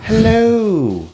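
high hammed-up British hello; mock British accent. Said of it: "speaking in a British accent".

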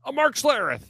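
very high, loud self-introduction; Mark Schlereth impression. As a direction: squeal out the name in character. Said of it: "announce who you are in our impressions".

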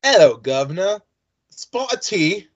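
mock posh English accent; lightly put-on English accent. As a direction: offer tea like a stage Englishman in English accent. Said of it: "See if I can still do this".

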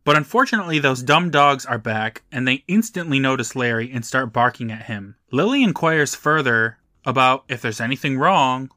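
ordinary talk

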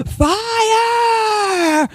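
put-on high, strained drunken yell; screaming fire. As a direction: belt it out like a bad rock singer. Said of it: "I start screaming".

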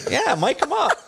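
high, laughing, cocky. Retold or imitated. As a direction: toss it off cheekily. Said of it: "I'm like".

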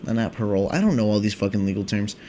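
ordinary delivery

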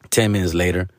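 ordinary delivery

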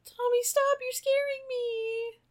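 high, slow frightened whine; mock scared kid. Cohost voicing a frightened kid, high and slow. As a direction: beg Tommy to stop like a scared child.

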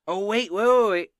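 high, loud, repeated halt; real excited outburst; urgent interruption. Real, not acted. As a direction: stop everyone with a sudden realization. Game master suddenly interrupts himself.

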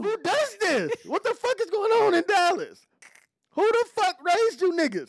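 high, loud incredulous rant; can't believe what he just saw; outraged disbelief. Real, not acted. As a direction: bellow your disbelief to the room. Outraged disbelief at plate eater.

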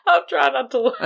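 real stifled laugh, pitched high and loud; words squeezed out while trying not to laugh; high, giggly struggle to speak; holding back laughter. Real, not acted. Fighting laughter at co-host's remark.

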